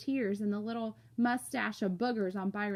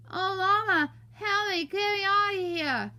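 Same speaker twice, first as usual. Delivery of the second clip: high, loud muffled wailing; panicked wail; 11 semitones higher, 6 dB louder than usual. Acted. Boy frozen to the mirror wails to Mama, panicked cry. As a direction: wail for Mama with stuck lips.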